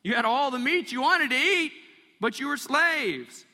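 exasperated retort. Retold or imitated. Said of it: "Moses could have said".

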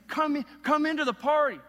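loud, high pleading father calling his son in; begging father. Retold or imitated. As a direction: plead with the son to come to the party. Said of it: "he is begging him".

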